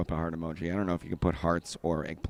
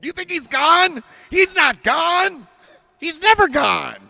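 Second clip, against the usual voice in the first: high, slow, hammed-up movie-star shouting; Al Pacino; 11 dB louder, slower than usual. Comic attempts Richard Dreyfuss screaming, shouted impression. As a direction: bellow each line slowly as a bad actor impression. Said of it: "that was more Al Pacino".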